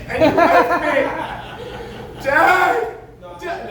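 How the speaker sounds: high wailing call for dad; hammed-up child crying out; mock abandoned child. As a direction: plays a left-behind kid yelling for his dad.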